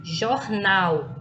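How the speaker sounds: "jornal" with weight on the last syllable NAL; stress on final syllable. Shown as a demonstration. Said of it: "sílaba nal com mais intensidade".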